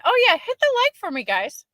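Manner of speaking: high, bright burst asking for likes; excited. Real, not acted. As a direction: jump up with eager energy.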